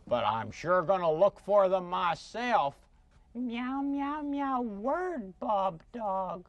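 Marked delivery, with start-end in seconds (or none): 3.3-6.5 meowing cat-puppet voice; pussycat puppet. Acted. Cat calling a friend to come, meowing cat voice. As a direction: talk as a little cat, meows between the words.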